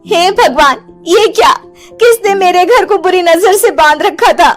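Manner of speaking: high-pitched dramatic dismay; alarmed shock. Acted. Woman finding her doll swollen by evil eye, alarmed. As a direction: gasp out the horrified questions, pitched high.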